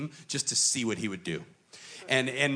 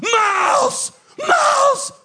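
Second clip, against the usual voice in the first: screamed name, much higher and louder; coach yelling; 13 dB louder, much slower than usual. Retold or imitated. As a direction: bellow the kid's name across the gym. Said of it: "so loud".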